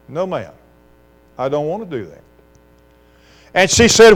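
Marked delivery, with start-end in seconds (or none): 0.1-2.2 imitated little boy, hushed and low; quiet refusal. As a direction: say it small and soft, a child defying mama. Small boy quietly refuses mother.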